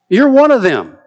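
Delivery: lightly voiced girl pointing at Peter; servant girl accusing. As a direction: jab the accusation at him like a nosy servant girl.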